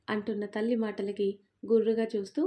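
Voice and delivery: ordinary delivery, nothing marked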